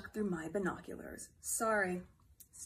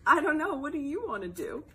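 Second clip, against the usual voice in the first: normal talking voice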